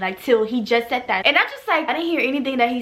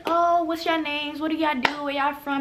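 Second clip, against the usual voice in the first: lightly voiced chatty girls, higher and softer; light higher-pitched mock of the other girls; imitating friendly girls; 4 semitones higher, 3 dB quieter than usual. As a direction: mimic polite small talk, lifted in pitch. Acting out the girls' small talk.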